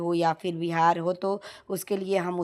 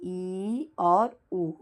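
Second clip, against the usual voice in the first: आ, ई, उ each stretched slowly; long vowels demonstrated slowly; drawn-out vowels; much slower than usual. Shown as a demonstration. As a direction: hold every long vowel out, very slow. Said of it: "दीर्घ स्वर कहलाते हैं".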